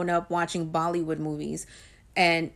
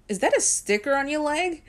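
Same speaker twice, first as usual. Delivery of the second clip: mom's suspicious question; 7 semitones higher than usual. Retold or imitated. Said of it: "she asked me".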